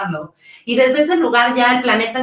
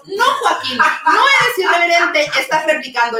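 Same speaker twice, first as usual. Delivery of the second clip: higher, emphatic no; raised, indignant comeback; heated retort; 7 semitones higher, 3 dB louder than usual. Real, not acted. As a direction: call him out with conviction. Retorting to mocked comedian.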